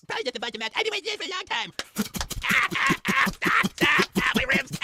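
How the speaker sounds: very high, squealing cries of pain; squeaky pain voice. Pretend. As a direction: squeal as the blows land. Pinata beaten, squealing voice.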